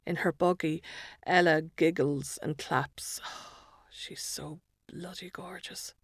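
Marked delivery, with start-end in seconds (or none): 3.2-5.9 soft, adoring whisper; hushed with love for the baby; tender whisper. A narrator's reading. Mother adores her baby.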